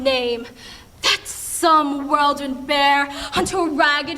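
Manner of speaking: anguished Shakespearean soliloquy, long dramatic pauses; sad, frustrated Julia. Acted. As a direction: rail at yourself in drawn-out stage speech. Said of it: "Julia is sad and frustrated at herself".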